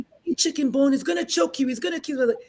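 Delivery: high, shocked gasp of disbelief; alarmed kids. Retold or imitated. Classmates at school, shocked by a chicken bone, alarmed. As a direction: squeal it like horrified schoolkids.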